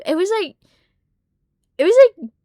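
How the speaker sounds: ordinary talk, nothing marked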